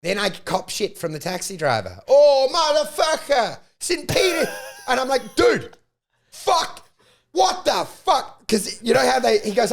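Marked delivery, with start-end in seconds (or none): 2.1-4.5 high, slow, louder mock of a cursing cabbie; angry taxi driver. Taxi driver abusing him. 5.4-8.5 high, slow shouted disbelief; outraged shout. Retold or imitated. Arguing with taxi driver.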